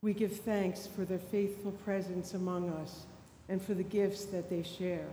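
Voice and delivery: usual speaking voice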